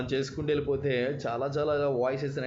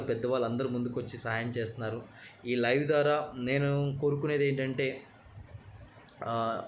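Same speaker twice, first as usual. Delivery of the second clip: usual speaking voice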